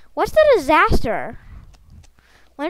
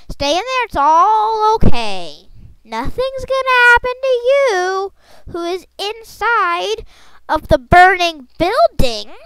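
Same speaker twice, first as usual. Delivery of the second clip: high, loud mock-reassuring holler; calling out; 8 semitones higher, 7 dB louder than usual. Player calls to characters in a burning building, calling out. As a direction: call out to people inside, stretching the words.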